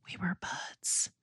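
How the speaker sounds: hushed secret whisper. Shown as a demonstration. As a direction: drop to a breathy whisper, like sharing a secret. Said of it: "I don't want everyone to hear".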